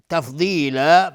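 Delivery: تفضیلا shouted high and loud with the end drawn out; long final madd. Shown as a demonstration. Teacher demonstrating the madd iwad, loud and high. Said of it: "مد عوض".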